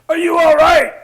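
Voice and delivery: high, loud worried call. Retold or imitated. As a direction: shout it down to a man in the ditch. Stranger calls to crashed rider.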